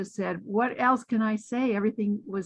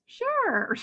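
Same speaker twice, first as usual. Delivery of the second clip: imitating Armand's cheery answer. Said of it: "And Armand goes".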